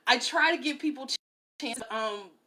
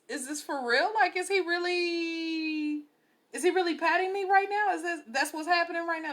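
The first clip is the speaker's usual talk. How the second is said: higher-pitched disbelief, stretching the words; retold bewildered questioning; incredulous; 6 semitones higher than usual. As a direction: question it as if you can't believe it. Stunned at being patted down.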